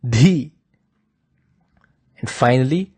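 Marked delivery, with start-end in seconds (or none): 0.0-0.5 "Dhi" with a forceful puff of breath; emphatic, heavily aspirated "Dhi"; strong aspirated dh. Shown as a demonstration. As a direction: push air hard through the Dh of "Dhi". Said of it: "it is a strong".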